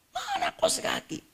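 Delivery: very high, squeezed mock yell; mock an irate husband in a screechingly high pitch; angry husband. Husband angry about missing socks, high-pitched mock rage. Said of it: "suami marah gara-gara kos kaki".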